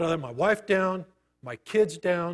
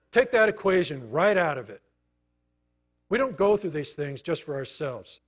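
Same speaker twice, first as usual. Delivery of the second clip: ordinary talk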